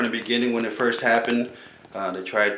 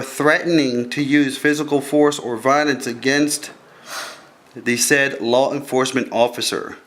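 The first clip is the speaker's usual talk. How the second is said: formal reading; 4 dB louder than usual. Acted. Reads out the formal charge.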